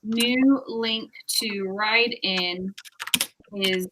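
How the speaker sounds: slow, spaced-out dictating; slow dictation. Real, not acted. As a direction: read it out slowly as you type. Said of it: "I'm gonna say".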